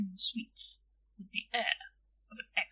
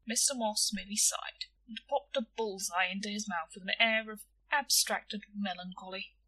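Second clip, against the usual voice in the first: plain reading voice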